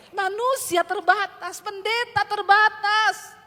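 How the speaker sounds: preacher telling the church that pastors are limited, slow and loud; slow loud preaching. Acted. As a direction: drag each word out, loud and deliberate.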